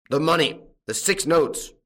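high, loud roar; angry farmer's bellow. A narrator's reading. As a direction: roar out the demand. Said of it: "roared the farmer".

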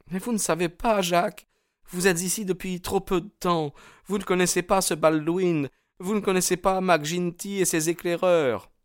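put-on high, urgent young woman; Etty, anxious warning. A narrator's reading. Young woman warns suitor about the gang, high anxious voice.

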